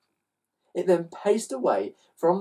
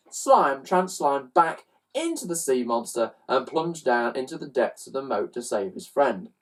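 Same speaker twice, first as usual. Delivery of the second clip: usual speaking voice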